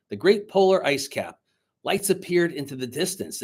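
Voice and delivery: lively read-aloud storyteller voice; story narration. A narrator's reading. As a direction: read the story to the class with energy. Teacher reads story aloud.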